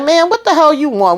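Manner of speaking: high, irritated snap; annoyed. Retold or imitated. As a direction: brush her off sharply. Voicing Chastity annoyed.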